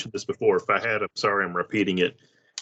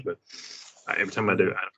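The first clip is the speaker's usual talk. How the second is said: ordinary talk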